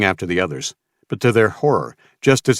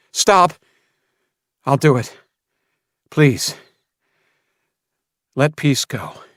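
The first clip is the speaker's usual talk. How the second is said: high, loud desperate cry; desperate plea; 7 semitones higher, 3 dB louder than usual. Acted. Samuel giving in to Mason, desperate plea. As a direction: break and beg.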